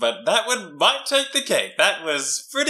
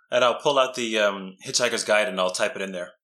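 ordinary talk

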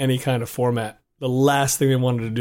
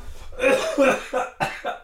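coughing; 12 semitones higher, 4 dB quieter than usual. Real, not acted. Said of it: "I'm coughing".

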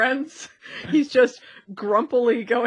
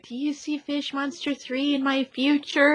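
higher, hammed-up bad-actress voice; badly acting; 5 semitones higher than usual. Actress asking about her film part in a reading, badly acted. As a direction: imitate an actress overplaying her lines badly. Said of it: "while she's badly acting like".

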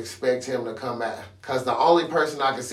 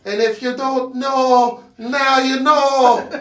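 put-on Irish accent; 10 semitones higher, 5 dB louder, slower than usual. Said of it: "Worst Irish accent I've ever heard".

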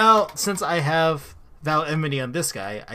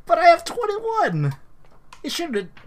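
high, pleading disbelief; outraged protest; 14 semitones higher than usual. Real, not acted. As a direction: insist it's unfair. Protest again.

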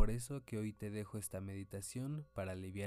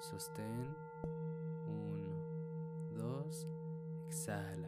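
slow, spaced counting of breaths; slower than usual. Shown as a demonstration. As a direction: count each breath calmly and slowly. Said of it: "counting breaths in a meditation". (conteo lento de respiraciones)

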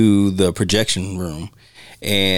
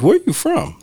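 a higher, asking local's voice; curious local; 13 semitones higher than usual. Retold or imitated. Local asking a stranger, lightly acted and higher. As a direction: play a nosy villager. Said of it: "people are gonna ask".

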